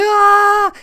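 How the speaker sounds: high, loud sustained "aah" scream; imitated scream. Man imitates mother-in-law dropping down the slide, screaming. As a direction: mimic a scream heard through the tube. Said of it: "you hear her screaming through the tube".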